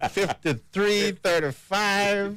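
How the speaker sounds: high, drawn-out number-calling chant; mock caller. Joking co-host imitates people writing down the number, mock calling. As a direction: stretch the numbers out slowly, high-pitched.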